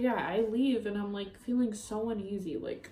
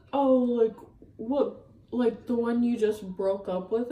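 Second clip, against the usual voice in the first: mocking the other girl; 2 semitones higher, 6 dB louder than usual. Mocking the other girl's reply.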